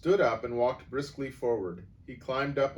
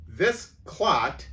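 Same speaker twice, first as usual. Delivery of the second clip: louder, higher booming voice; boomed; 7 semitones higher, 9 dB louder than usual. A narrator's reading. Headmistress denouncing the boy, booming. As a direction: boom it with contempt. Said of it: "boomed the headmistress".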